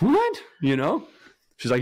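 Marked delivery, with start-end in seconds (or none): 0.0-0.4 high-pitched, incredulous yelp; shocked disbelief. Retold or imitated. Husband retells hearing wife's confession, shocked disbelief. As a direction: shoot way up in mock shock.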